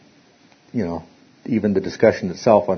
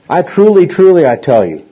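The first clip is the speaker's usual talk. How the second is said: "truly" hit higher and louder; double emphasis; 7 semitones higher, 7 dB louder than usual. Shown as a demonstration. Teacher demonstrates Jesus' emphatic "truly, truly" to class, stressed. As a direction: punch both "truly" hard. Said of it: "emphasize, double emphasis".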